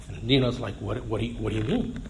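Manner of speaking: a hushed what do you mean; mock bewildered hush. The accusers' confused reaction.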